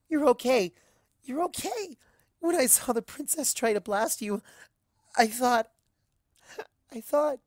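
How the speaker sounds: high, breathless, overwhelmed relief; words tumbling out in worried relief; tearful relief. Acted. Azalea hugging Twilight after the blast, choked relieved gush. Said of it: "she gives up on words".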